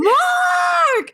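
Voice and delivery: very high, drawn-out excited scream; screaming a name. Retold or imitated. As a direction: re-enact shrieking to a friend across the lot. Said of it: "I just start screaming".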